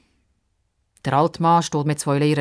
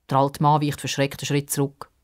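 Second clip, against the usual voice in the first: usual speaking voice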